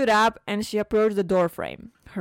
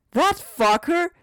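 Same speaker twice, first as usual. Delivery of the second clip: outraged outburst; 10 semitones higher, 7 dB louder than usual. Acted. Mother hears daughter was insulted.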